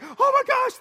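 high squeal of excitement; squealing fan. Retold or imitated. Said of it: "they're like".

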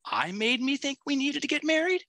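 high pitched, rising like a question on married; up talk. Retold or imitated. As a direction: quote her as if asking, climbing to married. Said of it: "She said it like a question".

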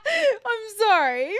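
high, slow laugh through the words; can't stop laughing while apologizing. Real, not acted. Cracking up at gulp noise.

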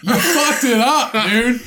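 loud laughing jeer; laughing taunt. Real, not acted. Co-host laughing loudly at his friend's fumble. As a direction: crack up and jeer at the flub. Teasing co-host's flub.

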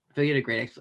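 ordinary delivery

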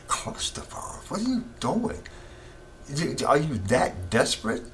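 a hushed, unvoiced "Cluster bombs?" then a sudden high "What are you doing?"; can't believe what he just heard; incredulous. Real, not acted. Disbelief at news of bombs.

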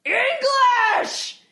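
high mock yell of a single word. Friend shouting from six feet back, high yell. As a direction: call it out from behind. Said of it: "just yells out".

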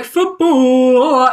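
loud, drawn-out football cheer; cheering football fan. Acted. Soccer fan showing off club loyalty, loud hammed-up cheer. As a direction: hoot it out like a supporter. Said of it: "I can be like".

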